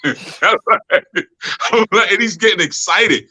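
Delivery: ordinary talk, nothing marked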